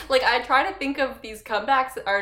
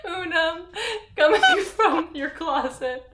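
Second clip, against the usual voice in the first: laughing; 9 semitones higher, 4 dB louder than usual. Real, not acted. Laughing retelling the witch line.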